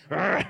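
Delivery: loud growled roar; prayer roar. Retold or imitated. Pastor imitating his own prayer roar again. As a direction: bark it out fierce. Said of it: "I'm like".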